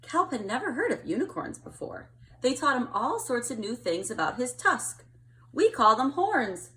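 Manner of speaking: put-on gentle storytelling read to kids; picture book reading. A narrator's reading. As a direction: read it like to a child at bedtime.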